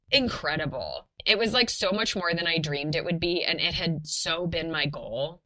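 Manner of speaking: normal talking voice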